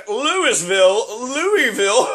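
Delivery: a hint of a snooty correcting voice; lightly voiced smug corrector; mocking know-it-all. His younger self mocking people's pronunciation, mild snooty voice. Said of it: "You're not saying that".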